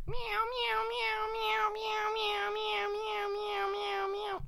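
very high squeaky cat meows, over and over; imitating a cat. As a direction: mimic a cat crying nonstop outside. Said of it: "and I just hear, like".

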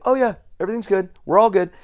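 mock doctor hiding his panic, chirpy and rushed; nervous doctor. Doctor brushing off the patient's worry, a squeaky hurried reassurance. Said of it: "he's like".

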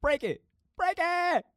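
building from loud call to high yell; escalating high-pitched hollering; breaking free. Pretend. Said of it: "trying to get out of my shell".

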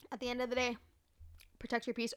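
breathy ASMR whisper into the mic. Acted. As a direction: hush it like an ASMR video. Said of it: "I'll give you some more A S M R".

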